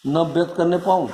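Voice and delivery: ordinary talk, nothing marked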